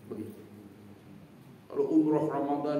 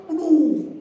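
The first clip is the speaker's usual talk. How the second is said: startled cry; 10 semitones higher, 10 dB louder than usual. Retold or imitated. Crushed in crowded mosque.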